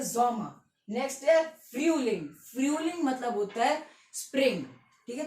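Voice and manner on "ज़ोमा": ज़ोमा falling, "ZOH-ma"; German pronunciation. Shown as a demonstration. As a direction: start ज़ोमा on a voiced Z, leave it open. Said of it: "summer को हम क्या बोलते".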